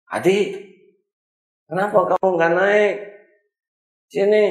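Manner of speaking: put-on high, loud call to a child; a caring teacher shouting from the bus door. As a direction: call the boy over to the bus. Teacher calls to left-behind boy.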